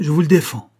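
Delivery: loud menacing warning to a mocking master; furious outcry. A narrator's reading. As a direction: forbid him to say another word. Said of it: "s'écria-t-il".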